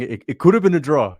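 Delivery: put-on quoting the Nigerian show guest in his accent. Mocking the Nigerian guest who was coping, in a Nigerian accent. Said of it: "And this guy was saying".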